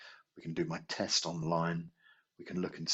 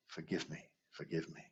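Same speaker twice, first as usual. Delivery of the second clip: softer, trailing gentle repetition; fading plea; 9 dB quieter than usual. Shown as a demonstration. Teacher demonstrating the forgiveness line, softly fading. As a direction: let each plea fade away. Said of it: "the next line of Ho'oponopono is".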